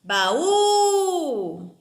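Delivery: "Baú" swelling loud on the stretched last syllable; stressed syllable called out. Shown as a demonstration. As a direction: call out "Baú", holding the final vowel. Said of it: "Eu chamo a palavrinha como se".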